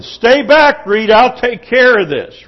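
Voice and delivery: heroic protective command. Acted. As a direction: call out like the brave protector. Acts out heroically protecting Reed.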